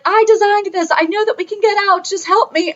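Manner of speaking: high-pitched comic pleading; Austin Powers henchman. Retold or imitated. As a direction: imitate a trapped henchman begging for help. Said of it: "he said it in the same tone".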